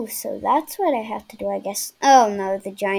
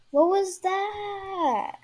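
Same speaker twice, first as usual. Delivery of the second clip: slow, higher, drawn-out disbelief; stunned disbelief; 8 semitones higher, 3 dB quieter, much slower than usual. Real, not acted. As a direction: stretch each word in shock.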